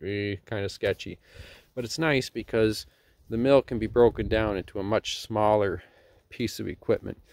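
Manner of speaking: usual speaking voice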